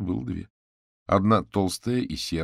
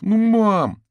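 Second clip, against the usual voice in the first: loud, high, drawn-out plea; whining boy; 13 dB louder than usual. A narrator's reading. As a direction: whine at mum like a kid. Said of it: "опять затянул пацан". (капризное мальчишеское нытьё)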